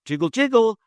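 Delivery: high, loud door-handle jiggle; door jiggle sound. Retold or imitated. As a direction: mimic a rattling door handle.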